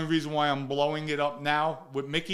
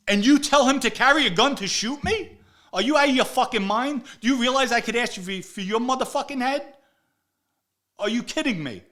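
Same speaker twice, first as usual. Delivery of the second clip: loud, high furious confrontation; aggressive; 8 semitones higher, 6 dB louder than usual. Retold or imitated. Mobster confronting Blaze over the gun, furious shouting. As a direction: tear into him, outraged and threatening. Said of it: "And now I'm getting aggressive".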